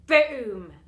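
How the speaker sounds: loud booming crash sound. A narrator's reading. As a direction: bang it out like doors bursting. Doors burst open.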